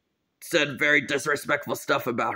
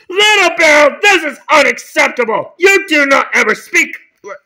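loud, raised-pitch reprimand; angry scolding; 3 semitones higher, 4 dB louder than usual. Acted. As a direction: lay down the law loudly. Principal scolds pupil.

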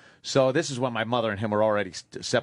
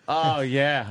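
normal talking voice

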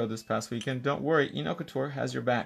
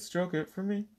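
higher, lightly imitated singer's line; a hint of a singer; 6 semitones higher, 4 dB quieter than usual. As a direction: quote a pop lyric playfully, pitched up. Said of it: "Like [name] says".

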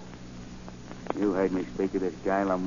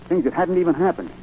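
higher, louder excited protest; agitated insistence; 8 semitones higher, 6 dB louder than usual. Acted. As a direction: push it out urgently. Spud insists defensively.

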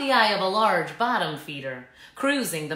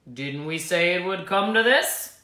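put-on slightly lower, reproachful old snake; lightly voiced old Green; 3 semitones lower than usual. A narrator's reading. Old Green scolding Verity as he lies hurt. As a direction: tut at the fallen youngster. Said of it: "Umble said".

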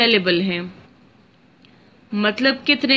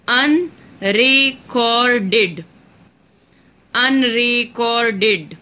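Unrecorded drawn out as un-re-cor-ded; slow syllable by syllable; much slower than usual. Shown as a demonstration. Teacher to students counting syllables, drawn out slowly. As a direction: spell out every beat of Unrecorded slowly. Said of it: "Four syllable word".

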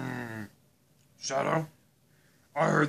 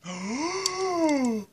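high long gasp of shock; 13 semitones higher than usual. Pretend. Dad hearing the theft, sharp shocked gasp. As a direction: gasp at the confession.

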